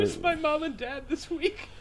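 high, slow mock child asking. Rented child asking about new parents, high and slow. Kid voice in rental joke.

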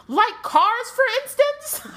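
high, loud, slow furious wife; angry Molly. Acted. Wife rounding on her husband over the enchanted car, high loud fury. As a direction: shriek it at your husband. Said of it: "She's angry".

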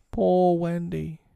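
high, long-drawn plaintive call; imitated mournful bird cry; mild moan. A narrator's reading. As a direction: moan it slowly, pitched high. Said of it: "as it flies it moans".

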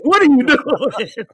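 laughing disbelief. Retold or imitated. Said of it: "I was like".

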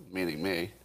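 ordinary delivery

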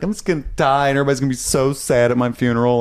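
put-on high, fast, whiny kid voice; mock upset kid. Recalling childhood drama to co-hosts, high fast whiny kid. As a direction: play a self-pitying child. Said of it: "you remember getting upset as a child and being like".

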